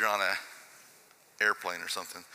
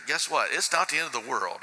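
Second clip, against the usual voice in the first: ordinary talk, nothing marked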